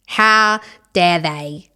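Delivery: put-on very slow, drawn-out Australian accent; bad Australian accent. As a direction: overdo a poor Australian accent, word by word. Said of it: "the worst Australian accent".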